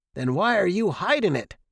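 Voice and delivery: irritated parental interrogation; accusing father. A narrator's reading. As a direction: challenge the boy like an angry dad.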